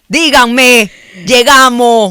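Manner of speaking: loud, high laughing cheer; laughing victory shout; triumphant cheer. Real, not acted. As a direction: shout it like you just arrived in triumph.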